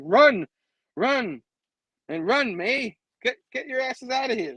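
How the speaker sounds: a touch of alarm; urgent warning. Retold or imitated. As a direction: urge them to run, mock-urgent. Acting Janice urging them to flee.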